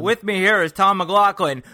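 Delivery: high, loud announcer delivery; fake radio voice. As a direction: introduce the guest like a DJ. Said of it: "this fake radio voice".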